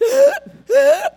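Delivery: sharp strained gasp for air; choking gasp. Shown as a demonstration. Preacher miming choking, a strained gasp. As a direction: gasp like you can't breathe out. Mimes choking on inhale only.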